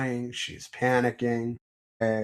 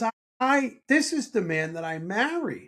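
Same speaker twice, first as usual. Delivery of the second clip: imitating moved wife; 10 semitones higher than usual. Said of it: "She goes".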